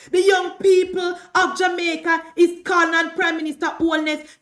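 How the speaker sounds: fiery political rant; impassioned shout. Real, not acted. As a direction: shout it to the crowd, building.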